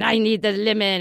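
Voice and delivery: loud, insistent mock French chef; hammed-up demand in a French chef voice; arguing chef. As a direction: argue loudly as the passionate chef. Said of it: "the chef is saying".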